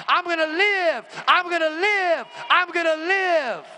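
high, loud, rising declaration of faith; loud exultant cry that you will live; triumphant shout. Retold or imitated. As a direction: proclaim it like you just got saved. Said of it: "I said".

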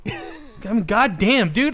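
ordinary delivery

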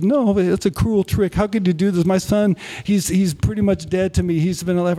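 high-pitched, upset protest; acting out a mother who refuses to believe; retold hurt disbelief, pitched well up; distraught mother. Mother refuses to believe son returned.

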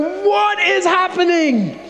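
high, drawn-out wail of alarm; mock panic. Retelling his shock as things got weird, mock panicked wail. Retold panic at girl's convulsions.

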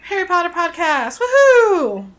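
loud, high whoop of excitement; excited cheer. Real, not acted. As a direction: cheer it out like a show host. Cheering the podcast topic.

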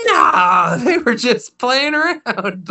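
high little dog voice, brushing it off; chihuahua voice. Retold or imitated. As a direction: shrug it off in a squeaky dog voice. Chihuahua answers.

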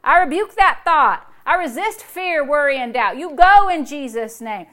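loud, forceful rebuke; preacher acting out a stern rebuke; rebuking the devil. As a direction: order the devil out with authority. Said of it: "At first I say".

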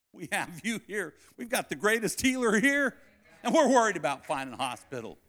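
impassioned pulpit prayer; preaching prayer. Real, not acted. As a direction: plead with God, rising in force.

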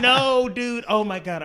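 high, loud eager reassurance; excited fan. Retold or imitated. Reassuring the architect he's a real fan, excited. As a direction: bubble over with excitement.

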